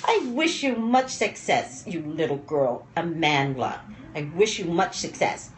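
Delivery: ordinary talk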